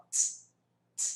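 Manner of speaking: bare "t", a voiceless release with no vowel; isolated T sound. Shown as a demonstration. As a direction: demonstrate "t" as a short puff. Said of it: "Som de T de novo".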